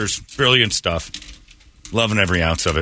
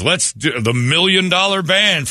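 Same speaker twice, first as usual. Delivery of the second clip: lightly hammed-up announcer, drawn out; higher, slow mock introduction of an act; 9 semitones higher than usual. Radio host mocking the halftime band's introduction, mock announcer.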